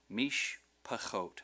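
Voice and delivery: "mishpachot" slowly, pausing after mish; partly joined syllables. Shown as a demonstration. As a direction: say "mishpachot" as mish, then pachot together. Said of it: "becomes a syllable with that consonant".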